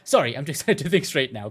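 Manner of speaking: normal talking voice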